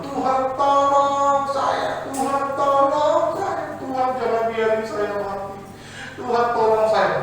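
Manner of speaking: high, slow pleading wail; hanging climber crying out for help; desperate cry to God. Acted. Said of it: "dia mulai teriak sama Tuhan".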